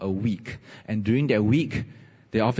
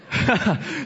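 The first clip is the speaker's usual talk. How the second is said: laugh; 11 semitones higher than usual. Real, not acted. Laughs recalling rescue.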